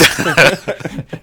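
short loud burst of real laughter. Real, not acted. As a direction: laugh at your own idea. Laughs at own preaching threat.

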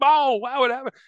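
high excited yell at a big play; big boisterous exclaim. Retold or imitated. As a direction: blurt it out like a thrilled play caller. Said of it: "this big boisterous".

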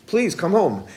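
usual speaking voice

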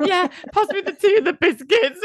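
high squealing through laughter; can barely get it out laughing; laughing hard. Real, not acted. Retelling to co-host, high laughing squeal.

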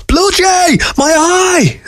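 very high squealing creature voice; tiny creature screeching in alarm; high-pitched screech. A narrator's reading. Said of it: "screeched Weasel".

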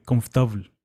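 confortable squeezed to konftobl, middle vowels dropped; Portuguese pronunciation. Shown as a demonstration. A pilgrim showing a listener how Portuguese sounds, compressed confortable. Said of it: "en portugués dirías".

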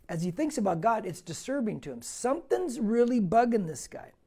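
ordinary talk

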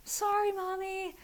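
put-on high, slow mumbled child's apology; a small girl forced to say sorry; sulky little girl. Daughter apologising to mommy, high sulky child voice. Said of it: "she's like".

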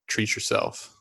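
breathy quoted catchphrase, lightly voiced; a hint of a sitcom character; Tom Haverford. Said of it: "As Tom Haverford might say".

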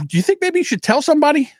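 a hint of a cheeky rep; tech guy. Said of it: "He goes".

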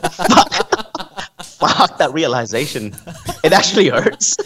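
real laughter, cheeks aching; breathless from laughing too hard; wheezing through the words after a long laugh. Real, not acted. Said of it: "my cheeks hurt from laughing".

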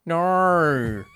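drawn-out, exaggerated Aussie "no"; put-on Australian accent. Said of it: "an Australian guy putting on an accent".

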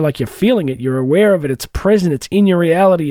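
ordinary talk

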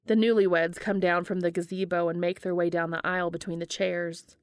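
ordinary delivery

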